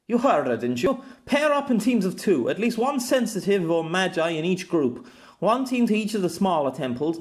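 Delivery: gruff commander voice; commanding officer. Acted. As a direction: rattle off orders to the squad. Jem gives orders to squad.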